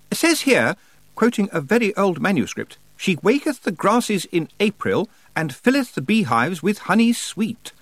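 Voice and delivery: high-pitched scholarly witch quoting verse; Miss Tick, bookish reciter. Acted. Miss Tick quotes an old manuscript to Tiffany, reciting. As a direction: recite it high, drawing out the last words.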